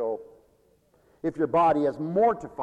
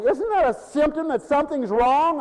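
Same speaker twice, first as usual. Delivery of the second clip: ordinary talk, nothing marked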